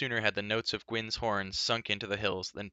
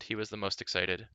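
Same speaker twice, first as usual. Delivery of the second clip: ordinary delivery, nothing marked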